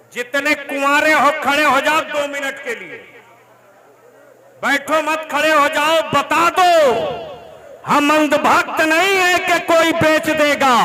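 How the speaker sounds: loud, high, full-force sermon; fiery preaching. Real, not acted. Preacher rousing unmarried young men, full force. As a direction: whip the crowd up.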